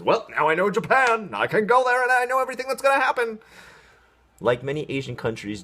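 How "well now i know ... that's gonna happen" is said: much higher, louder, fast mock-dumb voice; squeaky overconfident know-it-all; naive viewer. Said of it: "no one watches that whole cartoon and says".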